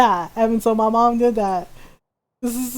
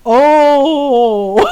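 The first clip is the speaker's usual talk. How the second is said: loud, drawn-out "oh"; sympathetic shock; 7 dB louder than usual. Retold or imitated. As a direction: wince in sympathy, long and loud. Reacting to brother's painful story.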